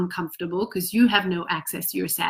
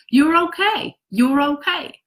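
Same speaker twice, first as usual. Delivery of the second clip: higher-pitched, rising mock reassurance; imitated parental reassurance; 6 semitones higher, 3 dB louder than usual. Parent to crying child, imitated dismissive reassurance. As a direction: imitate a parent brushing off tears.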